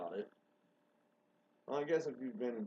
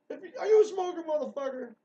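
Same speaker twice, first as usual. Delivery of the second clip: much higher and louder, mocking challenge; a sneering shouted jab; angry; 11 dB louder than usual. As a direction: confront the rude guy in a mocking yell. Mocking a rude man in rant.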